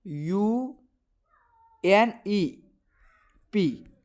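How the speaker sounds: very slow, letter by letter with long pauses; spelled out slowly. Shown as a demonstration. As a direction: draw out each letter of the acronym. Spelling out an exam acronym.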